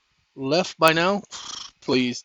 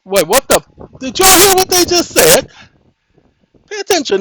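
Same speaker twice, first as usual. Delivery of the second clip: shocked outrage; 12 semitones higher, 9 dB louder than usual. Real, not acted. Outraged at ruling just heard.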